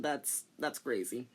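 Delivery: ordinary talk, nothing marked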